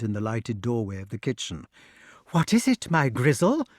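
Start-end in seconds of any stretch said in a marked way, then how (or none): 2.3-3.7 high-pitched witch calling to her pet; witch crying out. A narrator's reading. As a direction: call out sharply as an old witch. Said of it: "she cried".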